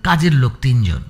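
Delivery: ordinary delivery, nothing marked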